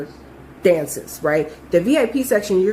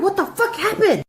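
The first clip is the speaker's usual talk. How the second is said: panicked demand; 10 semitones higher than usual. Retold or imitated. Panicked question to frozen coworker.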